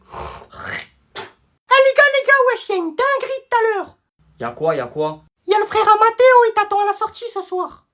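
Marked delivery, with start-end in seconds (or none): 0.1-4.0 high, drawn-out schoolkid voice; excited gossip. Acted. 5.5-7.9 high, loud schoolkid voice; gleeful warning. Acted. Schoolkid warns of fight.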